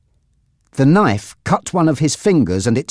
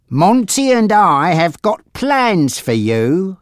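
higher, slow, smug threat; hint slowly at a nasty plan; scheming gangster Frankie; 7 semitones higher, slower than usual. Acted.

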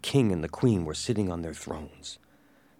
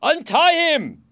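very high, drawn-out yell; shouted order; 10 dB louder, slower than usual. A narrator's reading. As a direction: shout the command to soldiers. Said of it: "he shouted to the soldiers".

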